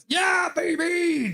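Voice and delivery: high, slow, drawn-out yell; yeah baby shout. Retold or imitated. Streamer voicing chat, drawn-out loud yell. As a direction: holler it like a wild fan. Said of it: "Donnie Blue's like".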